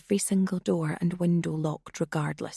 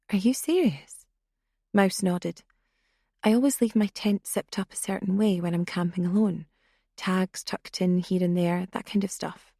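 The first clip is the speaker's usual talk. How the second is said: ordinary delivery, nothing marked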